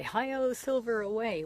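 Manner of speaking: high, loud heroic cowboy cry; rally the horse like the radio Lone Ranger; Lone Ranger call. Retold or imitated. Repeating the Lone Ranger's radio call, high heroic cry. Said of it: "he'd always say".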